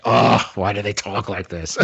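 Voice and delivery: mock exasperated groan into a complaint; exasperated daughter. Daughter hating the local accent, mock exasperation. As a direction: imitate a fed-up daughter, long groaned Oh. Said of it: "She's like".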